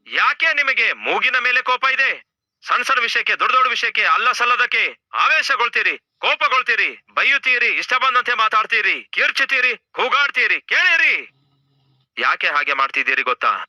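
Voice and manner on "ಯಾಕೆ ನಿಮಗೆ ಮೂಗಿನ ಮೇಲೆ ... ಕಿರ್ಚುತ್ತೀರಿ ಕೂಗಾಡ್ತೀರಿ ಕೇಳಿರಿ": high-pitched, insistent preacher's rebuke; scolding rebuke. Real, not acted. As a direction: reel off their faults, pitch held high. Preacher rebukes quick-tempered couples, listing faults. (ಎತ್ತರದ ಧ್ವನಿಯಲ್ಲಿ ಗದರಿಸುವುದು)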